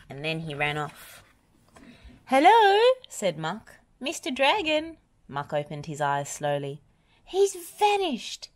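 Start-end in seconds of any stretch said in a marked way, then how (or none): 2.3-5.0 high, loud searching hello; calling out. A narrator's reading. Muck calls for the vanished dragon. 7.2-8.5 high, slow, astonished exclamation; gasped amazement. A narrator's reading. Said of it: "he gasped in amazement".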